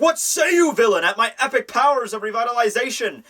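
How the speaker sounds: high, hammed-up challenge to a villain; grandiose hero. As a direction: proclaim your powers like a pompous hero. Voicing revitalized hero's grand challenge.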